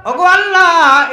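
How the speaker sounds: high, loud calling-out; preaching cry. Acted. Preacher voicing Noah calling to Allah, high and loud. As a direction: cry the plea up to heaven. Preacher calls out Noah's plea.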